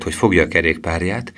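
ordinary delivery, nothing marked

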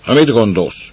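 a rushed servant snapping at a fool; gruff snap. Acted. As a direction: bite her head off, you're busy. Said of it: "mordult rá az".

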